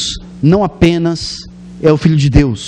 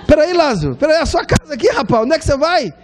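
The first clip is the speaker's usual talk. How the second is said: much higher, pleading playful voice; high hammed-up call to stay in heaven; playful call back; 11 semitones higher than usual. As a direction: call him back like a host losing a guest. God calls Lázaro back.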